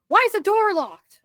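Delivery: high, loud retold demand; mad. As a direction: imitate an irritated husband demanding an answer. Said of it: "he's just mad".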